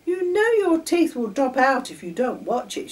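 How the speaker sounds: put-on high, loud, nagging little-girl voice; persistent. A narrator's reading. Girl nagging Charlie to brush, insistent. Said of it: "Patricia went on and on".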